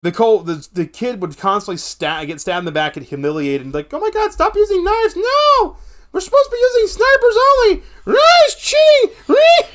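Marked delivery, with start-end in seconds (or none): 3.9-9.7 put-on very high, loud kid screaming in rage. Kid raging at the troll over knives, high furious screaming. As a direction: shriek like an outraged little gamer. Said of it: "the whole video just hit a kid screaming".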